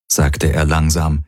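ordinary delivery, nothing marked

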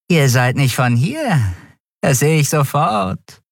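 high, sing-song fluting voice. Acted. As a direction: pipe it out sweetly. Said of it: "flötete der Mensch". (hoch flötend, säuselnd)

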